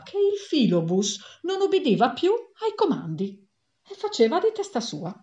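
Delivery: usual speaking voice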